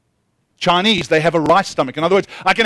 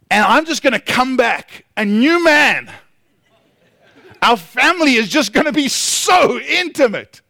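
hammed-up excitement, raised pitch; over-eager anticipation; 6 semitones higher than usual. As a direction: play the gushing, hopeful vacationer. Acting out high hopes before vacation.

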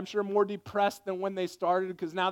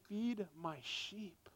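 slow tender hush; 14 dB quieter, much slower than usual. Acted. Jesus speaks softly to Peter.

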